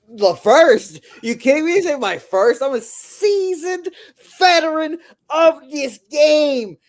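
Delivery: very high, loud mock outrage; mock indignation. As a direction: squeal a slow, hammed-up protest way up high. Mock protest at being called first-timer.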